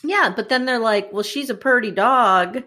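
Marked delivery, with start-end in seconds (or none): 1.1-2.6 put-on menacing Southern accent. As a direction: play a sly Southern dognapper. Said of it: "They didn't have Southern accents".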